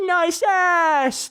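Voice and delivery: high, stretched hooligan holler; mock catcall. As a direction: imitate a rowdy catcall from a passing truck. Hooligans calling from a passing truck.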